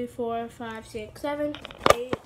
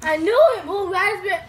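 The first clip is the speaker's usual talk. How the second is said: loud, triumphant I knew it; excited; 4 semitones higher, 7 dB louder than usual. Real, not acted. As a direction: shout it out, proud to be right. Triumphant flavor guess.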